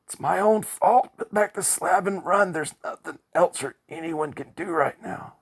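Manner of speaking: high, loud desperate pleading; scream of despair. A narrator's reading. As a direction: beg him to run as all hope goes. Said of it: "a scream of despair".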